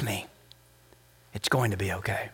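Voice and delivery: low, soft, whispered promise; whispered reassurance. Acted. God whispering to the weary, soft hushed reassurance. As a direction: breathe it like a secret comfort. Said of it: "He whispers".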